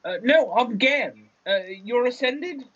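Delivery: high, hesitant character voice; Gan, puzzled. Acted. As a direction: answer confused, pitched right up. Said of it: "Gan says".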